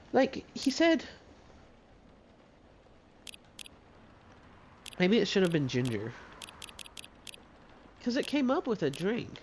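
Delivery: a hint of a high customer voice; lightly voiced high character.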